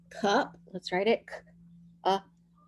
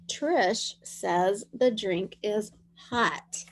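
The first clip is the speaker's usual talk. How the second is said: very slow dictation; much slower than usual. Shown as a demonstration.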